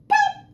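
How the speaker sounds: sharp high pop; high pop sound. Acted. Button pops in story.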